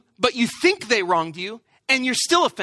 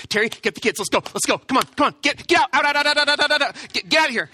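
urgent hurry; 4 semitones higher, faster than usual. Retold or imitated. Hurrying family out of the van.

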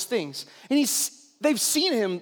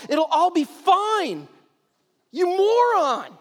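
high, raised hammed-up outrage; exasperated scolding; 6 semitones higher, 3 dB louder than usual. Disciples scolding Peter for his request, high mock-outraged yelling. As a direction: berate a friend in comic disbelief.

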